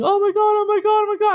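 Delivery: high-pitched mock scream of a brother; panicked screaming. Brother screaming after the crash, imitated panic. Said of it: "my brother screaming".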